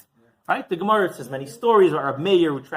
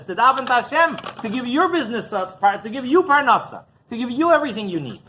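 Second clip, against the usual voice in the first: raised, higher preaching voice; impassioned urging; 6 semitones higher, 7 dB louder than usual. Acted. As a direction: press each phrase home with rising force. Rabbi urges man toward prayer.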